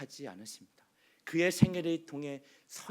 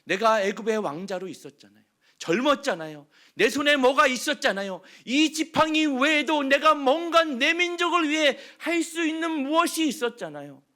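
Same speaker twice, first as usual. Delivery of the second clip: grievance building to a higher, louder protest; Moses complaining to God; 8 semitones higher, 8 dB louder than usual. Acted. Moses grumbling at God in the desert, rising protest. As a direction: pour out forty years of complaint. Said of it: "얼마나 원망하고 불평했을까요".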